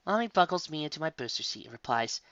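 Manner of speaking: usual speaking voice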